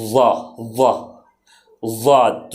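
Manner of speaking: وا drawn out slowly from the side of the tongue, then ضاد; slow, heavy ضاد demonstration; emphatic daad. Shown as a demonstration. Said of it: "آپ اس کو ایسے بولیں گے".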